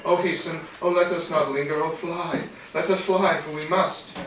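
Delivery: loud, high, urgent plea; terrified. A narrator's reading. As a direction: cry out in terror, begging to flee. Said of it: "In terror she spoke".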